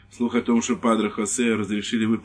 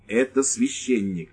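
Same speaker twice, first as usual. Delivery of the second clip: slow, deliberate words; slowly; slower than usual. Acted. Lieutenant slowly explaining to the wife. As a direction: weigh each word slowly. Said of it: "медленно произнёс лейтенант".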